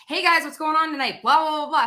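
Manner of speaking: cheerful waitress hello; upbeat server greeting. Shown as a demonstration. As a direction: greet the table with energy. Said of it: "You get one impression".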